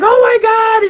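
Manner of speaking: high, loud starstruck gasp; fangirl squeal. Retold or imitated. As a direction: relive the moment of fangirling. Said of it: "And I was just like".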